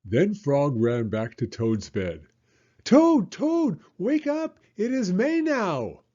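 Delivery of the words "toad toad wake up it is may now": high, loud wake-up call; excited shout. Acted. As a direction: shout the good news at the bed. Frog wakes Toad excitedly.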